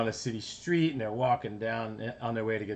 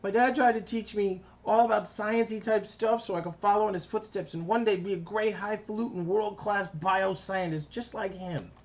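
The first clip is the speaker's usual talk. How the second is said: much higher cartoon kid voice; high little kid cartoon voice; Carbonate voice; 10 semitones higher, 3 dB louder than usual. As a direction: confess it like a small kid. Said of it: "He says".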